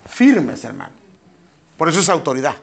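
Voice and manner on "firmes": "firmes" punched higher and louder; emphatic repeat. Real, not acted. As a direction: hammer the word "firmes" home. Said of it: "tenemos que mantenernos firmes". ("firmes" repetido con fuerza, más agudo y fuerte)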